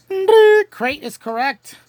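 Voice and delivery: host's drawn-out delighted call; excited confirmation. Real, not acted. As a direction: stretch it out like a big win. Host confirms answer with gusto.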